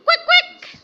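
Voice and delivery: high, loud double quack; duck quacking. Acted. Adult shows child the duck's noise, loud quacking. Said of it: "how dog make noise?".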